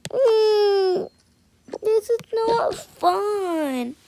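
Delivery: high, slow whimper; a kid on the verge of crying; slow high whining; whimpering child. Acted. Child upset in the blackout.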